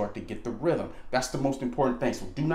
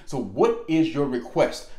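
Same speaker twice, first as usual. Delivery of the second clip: slow, clear stress on what; slow deliberate question; 3 dB louder, slower than usual. Shown as a demonstration. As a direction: spell it out slowly, leaning on what. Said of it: "make that nice, concise, and clear".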